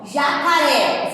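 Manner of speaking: jacaré stretched out slowly; slow pronunciation. Shown as a demonstration. Teacher helping pupils choose C or QU, jacaré pronounced slowly. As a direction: say jacaré slowly for spelling by ear. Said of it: "é só você fazer a pronúncia".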